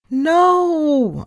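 long, high, loud wail of dismay; moaned. A narrator's reading. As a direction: moan it out high and drawn-out. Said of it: "I moaned".

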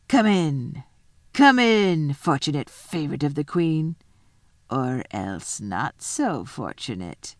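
wolf's call sinking to a slow, low threat. Acted. The wolf lets Edmund in, sinking to slow menace. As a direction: call out, then sink slowly into menace. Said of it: "presently the grey wolf".

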